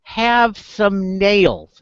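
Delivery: slow, stretched-out duck order; sly little duck. Acted. Duck changing its order at the bar, drawn-out voice. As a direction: draw each word out, starting high and loud. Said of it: "and he goes".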